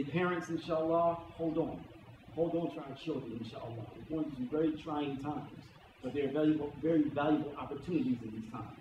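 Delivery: soft, low-pitched appeal to parents; gentle reassurance. Acted. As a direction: encourage them quietly to hold on.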